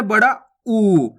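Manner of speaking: "ऊ" stretched into a long oo; drawn-out vowel. Shown as a demonstration. Teacher introducing the big oo, "ऊ" drawn out. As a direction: hold the vowel of "ऊ". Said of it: "खींच के पढ़ा जाता है".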